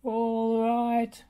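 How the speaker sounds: a lightly voiced rumbling roller. A narrator's reading. Rolly rumbling his answer to Bob, lightly voiced. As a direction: rumble it like a big machine. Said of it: "rumbled Rolly".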